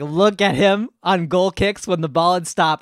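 ordinary talk, nothing marked

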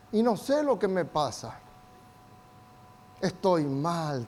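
soft, slow, dejected sigh of a line; mock dejected woman. Preacher voicing an afflicted woman to the church, soft and slow. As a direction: imitate a downcast woman who can't say what's wrong.

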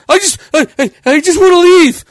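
hammed-up nervous stutter; stuttering nervous kid. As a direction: stammer like a scared kid fumbling keys. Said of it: "he's fumbling with the fucking keys".

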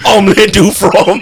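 mock French accent. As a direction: put on silly French through the laughs. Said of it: "my French is pretty good".

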